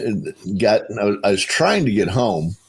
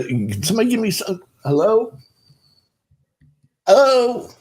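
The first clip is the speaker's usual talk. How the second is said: calling out for help; 11 semitones higher than usual. Retold or imitated. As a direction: call into the hall for anyone to come.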